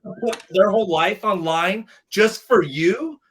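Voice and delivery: high, mocking instructor voice. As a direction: throw her scornful questions back at him. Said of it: "She goes".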